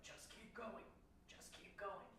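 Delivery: put-on a lightly gravelly, quiet old man's voice. Imitating the ghost's gravelly voice for the team. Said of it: "I can probably do it right now".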